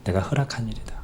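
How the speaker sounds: hushed whisper, gentle and sure; whispered God. Acted. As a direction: breathe it like a quiet divine answer. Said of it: "그때 하나님 뭐라고 얘기합니까?".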